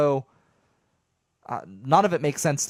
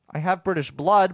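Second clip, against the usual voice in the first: ordinary talk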